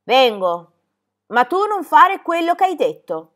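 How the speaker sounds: loud, anxious giving in; cowed by fear. A narrator's reading. Goatherd afraid his misdeed is known, uneasy pleading. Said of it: "Reso mongio dalla paura".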